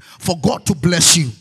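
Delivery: very loud preacher proclamation; shouted blessing. Real, not acted. Preacher blessing the congregation for the week, shouting. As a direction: throw the blessing out at full volume.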